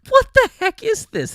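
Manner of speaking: high, loud, hammed-up startled thought; mock bewildered. Retelling her inner reaction to stage fright mid-concert, mock bewildered squeal. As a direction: squeal the sudden alarm. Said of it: "It's like".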